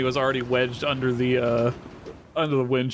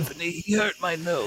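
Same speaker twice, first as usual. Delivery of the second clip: small snake voice; 3 dB quieter than usual. Acted. Macaroni complaining in character.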